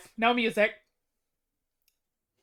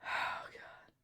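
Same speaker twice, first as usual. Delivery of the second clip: whispered; 22 dB quieter than usual. Real, not acted. Aside while waiting for votes.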